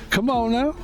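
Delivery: high, exaggerated showman catchphrase; Steve Harvey impression. Streamer mocks the host's catchphrase, over-exaggerated charisma. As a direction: push it up high and mumble off into laughter. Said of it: "And he's like".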